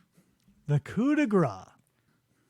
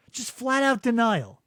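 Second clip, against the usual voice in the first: usual speaking voice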